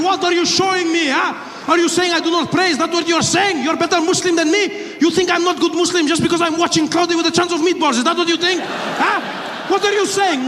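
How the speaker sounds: put-on high, accented barrage of offended questions; indignant passenger. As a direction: hector him in a put-on Middle Eastern accent. Acts indignant quarrel with terrorist.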